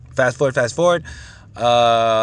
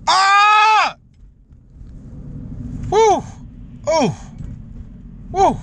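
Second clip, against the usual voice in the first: real scream; much slower than usual. Real, not acted. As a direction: let out a raw furious scream. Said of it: "I had to scream for you guys".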